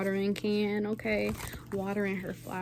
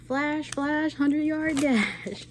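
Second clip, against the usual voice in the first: lightly voiced sloth, higher and drawn out at the end; a hint of the slow sloth, pitched up; sloth voice from Zootopia; 6 semitones higher, 3 dB louder, slower than usual. Imitating the Zootopia sloth for viewers, a light sloth voice. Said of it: "and he's like".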